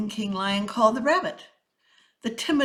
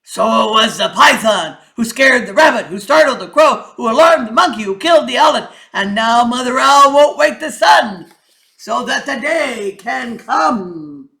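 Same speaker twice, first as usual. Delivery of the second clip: loud, ringing king's proclamation; lion king; 3 semitones higher, 15 dB louder than usual. Acted. King addresses the council, loud and commanding. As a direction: announce the chain of blame.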